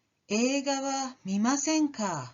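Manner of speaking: 映画は見ませんか with the pitch sinking at the end, not a question; falling tune. Shown as a demonstration. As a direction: let the last か drop like a disappointed remark. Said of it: "in a downward intonation".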